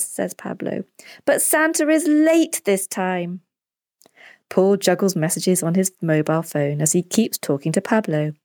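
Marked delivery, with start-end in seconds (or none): 1.2-3.4 put-on high, slow child's voice; drawn-out, high-pitched boyish grumble; impatient boy. A narrator's reading. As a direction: play a kid let down by waiting. Boy continues talking to his dad.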